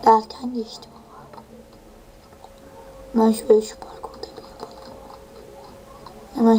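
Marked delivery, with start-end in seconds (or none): 0.1-4.8 hushed, breathy whisper to the camera; quick muttered whisper. Real, not acted. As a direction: keep it down, just breath. Whispering during gameplay.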